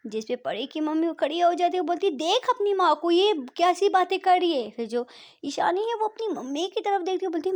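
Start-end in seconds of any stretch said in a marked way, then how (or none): 2.1-4.7 angry complaint. Acted. Mother complains to niece.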